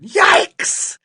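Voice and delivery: very high, loud yelp; sudden high-pitched shriek; startled yell. A narrator's reading. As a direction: yelp it out in alarm. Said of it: "turned around and yelled".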